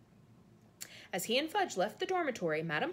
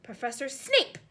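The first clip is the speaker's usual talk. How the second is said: loud, higher shriek of alarm; 5 semitones higher, 7 dB louder than usual. A narrator's reading. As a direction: shriek his name. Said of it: "shrieked Madame Pomfrey".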